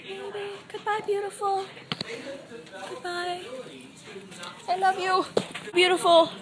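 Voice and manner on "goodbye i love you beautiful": normal talking voice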